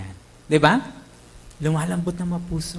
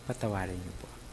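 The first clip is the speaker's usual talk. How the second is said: softer, lower, tearful plea for forgiveness; imitating sobbing plea; 8 semitones lower, 8 dB quieter, faster than usual. Repentant enemy begging the pastor, softer and tearful. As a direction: beg forgiveness quietly, near tears. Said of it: "Humahagulhol eh".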